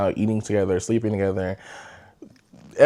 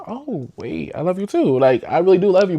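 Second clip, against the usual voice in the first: eager reply; 8 semitones higher, 3 dB louder than usual. Retold or imitated. Retelling her eager reply to him.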